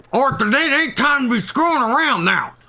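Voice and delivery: Dutch voice. Acted. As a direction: scold like an impatient leader. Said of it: "I think my Dutch voice is a lot better".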